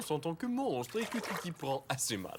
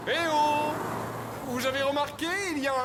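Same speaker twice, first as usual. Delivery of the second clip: calling out; 10 semitones higher, faster than usual. Acted. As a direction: hail the crowd from afar. Calling out to a crowd.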